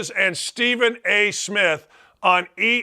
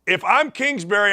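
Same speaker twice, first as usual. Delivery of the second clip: normal talking voice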